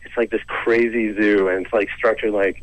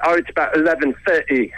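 high put-on British accent; hammed-up British lilt, pitched up; 8 semitones higher than usual. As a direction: answer in a mock British voice. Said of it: "there's this British guy".

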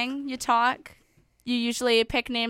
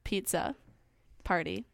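syllable by syllable; 3 semitones lower, 3 dB quieter, slower than usual. Shown as a demonstration. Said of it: "Can you repeat it?".

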